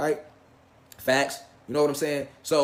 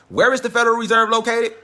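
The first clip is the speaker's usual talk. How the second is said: louder and higher, pressing the question; loud, higher emphatic re-ask; dramatic repeat; 6 semitones higher, 6 dB louder than usual. Shown as a demonstration. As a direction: hit it hard like a big reveal. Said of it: "Now it gets deep".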